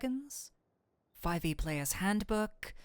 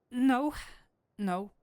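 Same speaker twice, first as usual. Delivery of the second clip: hasty refusal; 4 semitones higher than usual. Acted. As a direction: snap back into role. Catches herself, declines.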